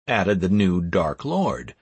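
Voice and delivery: ordinary delivery, nothing marked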